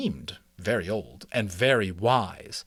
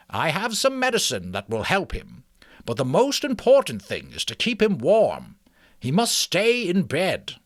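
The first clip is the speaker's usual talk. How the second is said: put-on higher, kindly old mouse; 7 semitones higher, 5 dB louder than usual. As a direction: speak as a wise old mouse. Ages speaks.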